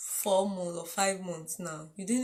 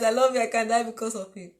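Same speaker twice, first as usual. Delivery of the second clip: fast singsong rattle of the suitor's line; recited like a memory verse; 4 dB louder, faster than usual. Retold or imitated. Mocking the boy who courted her mother, fast recitation. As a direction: rush it out like a memorized Bible verse. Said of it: "as if it is memory verse".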